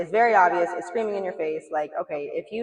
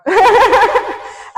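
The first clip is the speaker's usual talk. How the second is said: loud laugh; 14 dB louder than usual. Real, not acted. Laughs at her lizard-brain line.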